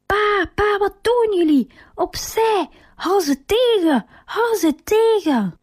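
high, urgent cries of a panicked son; alarmed calling out. A narrator's reading. Truman chasing the bus that takes his father, alarmed high cries. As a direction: call out in alarm, pitch way up, drawing out the last plea.

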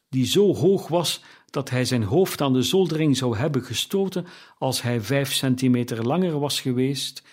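ordinary delivery, nothing marked

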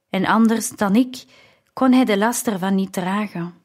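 usual speaking voice

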